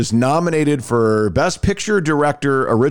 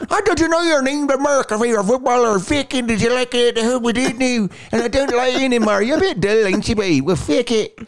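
much higher, hammed-up Irish brogue; Irish accent; 14 semitones higher than usual. Mocking the film's Irish actors with a high brogue. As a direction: mimic the film's thick Irish lilt. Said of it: "these guys are going like".

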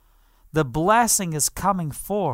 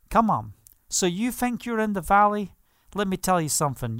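ordinary talk, nothing marked

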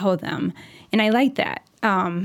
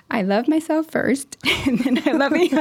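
little one's voice; 6 semitones higher than usual. Retold or imitated. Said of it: "the little one will say".

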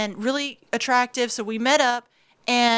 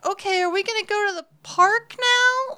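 nervous high; 9 semitones higher than usual. Retold or imitated. Retold nervous question to date.

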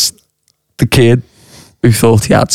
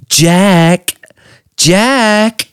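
high, drawn-out mystic calling of Jack; eerie psychic call; 7 semitones higher than usual. Retold or imitated. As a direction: summon him like a seer. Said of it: "looks me dead in the eye".

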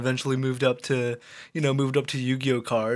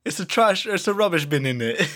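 higher, jokey Cockney; Cockney accent; 4 semitones higher than usual. Pretend. Host keeping up the chav joke, mock Cockney. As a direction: do a bad American take on Cockney. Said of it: "the Cockney voice".

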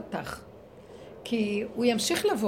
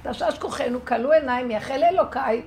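loud, higher firm scolding; real rebuke; 5 semitones higher, 6 dB louder than usual. Shown as a demonstration. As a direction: give it a real telling-off. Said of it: "נותנים לו גערה אמיתית". (גערה אמיתית, רמה וגבוהה)

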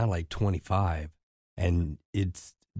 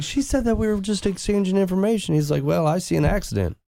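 high, exasperated officer retelling; incredulous officer; 12 semitones higher than usual. Retold or imitated. Officer protesting the ticket, high and exasperated. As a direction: squeak it out in disbelief.

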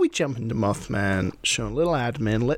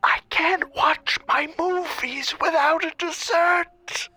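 creepy whisper; slower than usual. Acted. Spooky prank call opener.

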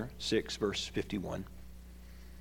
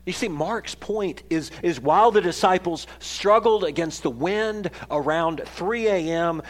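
usual speaking voice

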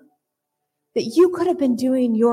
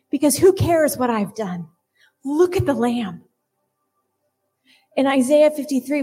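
usual speaking voice

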